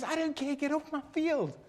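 high-pitched angry order; stern owner. Acted. Owner orders tractor fan off his field, high stern voice. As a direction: throw him off the land.

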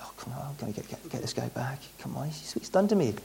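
breathy mock whisper of a grudge; imitated whisper. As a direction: whisper it like a tempted thought. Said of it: "I'm sure myself I would be like".

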